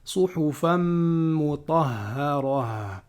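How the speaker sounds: slow measured chanting of the verse; Quran recitation. Acted. Teacher reciting Surah Al-Bayyinah to students, chanted. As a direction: recite each word with care.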